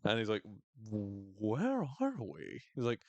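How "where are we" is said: very slow, dazed and spaced out; imitating a tripping Trav; tripping guy. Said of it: "so good as tripping Trav".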